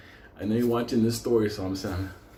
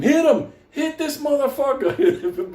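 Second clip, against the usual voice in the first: high, loud laughing shout; mock shouting; 11 semitones higher, 4 dB louder, slower than usual. Friend urging him to hit the coyote, laughing shout. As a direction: yell him on through laughter.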